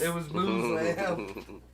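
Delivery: slow MUZ-lam, mimicking; mimicking a friend, slow. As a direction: drag out MUZ-lam like her. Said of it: "somebody that used to go".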